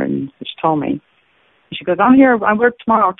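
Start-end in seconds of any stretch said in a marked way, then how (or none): 2.0-3.1 eager reply. Retold or imitated. Cashier eagerly answering.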